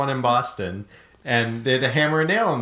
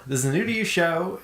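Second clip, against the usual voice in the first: faster; 6 semitones higher, faster than usual. Shown as a demonstration.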